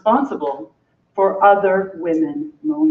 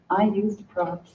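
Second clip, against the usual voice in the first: ordinary delivery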